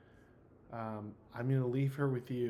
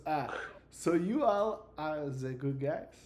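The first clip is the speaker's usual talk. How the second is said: put-on slow, higher, muddled French-Italian accent; 4 semitones higher, 5 dB louder, slower than usual. Sheltered character asking if the players are good guys, French-Italian accent. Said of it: "fifty-year-old Italian man".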